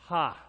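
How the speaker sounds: one loud burst of "Ha"; single loud laugh. Shown as a demonstration. Pastor laughs with congregation, one loud burst. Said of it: "Come on, laugh with me".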